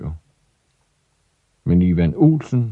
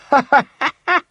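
put-on high jeering boys' laugh; mocking laugh. Big boys laughing at wet Ivan, high mock laugh. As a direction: laugh at him from above.

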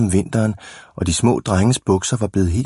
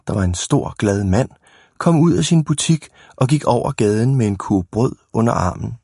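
ordinary delivery

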